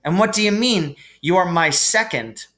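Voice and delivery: Harry asks Ron, mildly alarmed; a touch of alarm. Acted.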